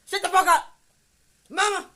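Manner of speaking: high, very loud mock yell. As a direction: act out shouting down your mother disrespectfully. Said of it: "never raise my voice at my mama".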